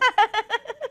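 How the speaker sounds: sudden laugh jumping up high; high burst of laughter. Real, not acted.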